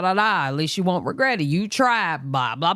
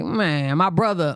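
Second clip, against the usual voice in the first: usual speaking voice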